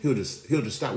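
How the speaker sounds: ordinary delivery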